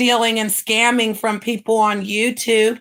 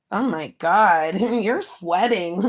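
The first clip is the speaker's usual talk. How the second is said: slow amused teasing through laughs; laughing mockery; 4 dB quieter, slower than usual. Real, not acted. Mocking a sweating rival, slowly laughing. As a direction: chuckle at how hard she's trying.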